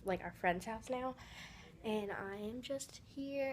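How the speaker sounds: usual speaking voice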